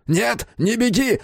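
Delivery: high, urgent counter-yell; high-pitched drawn-out cry. A narrator's reading. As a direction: holler to stop someone running. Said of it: "заорал Безил".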